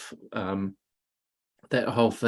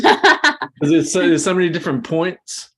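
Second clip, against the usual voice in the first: laughing, louder and higher; 6 semitones higher, 9 dB louder than usual. Real, not acted. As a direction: laugh through the words. Laughing while starting to talk.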